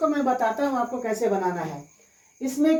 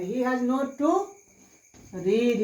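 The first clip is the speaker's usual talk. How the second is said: very slow, word by word; slow dictation; much slower than usual. Shown as a demonstration. Teacher building the sentence for students, word by word slowly. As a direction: lay the words down one by one. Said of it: "teacher builds sentence".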